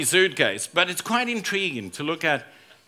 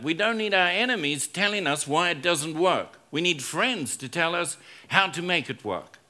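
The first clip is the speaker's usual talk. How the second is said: ordinary talk, nothing marked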